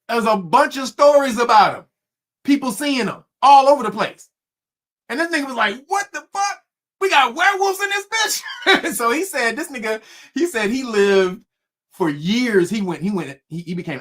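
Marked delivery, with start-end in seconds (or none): 5.9-8.4 much higher, startled cry of disbelief; high incredulous exclamation; shocked outburst. Retold or imitated. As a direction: yelp it out in total shock. Retold shock at werewolves.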